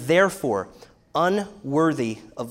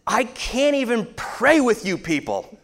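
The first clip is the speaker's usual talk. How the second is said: retold exasperated outburst, louder, with a laugh; 7 semitones higher, 4 dB louder than usual. Father fed up with sons during bedtime prayer, exasperated snap. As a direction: snap it in disbelief, half laughing. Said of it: "and I said".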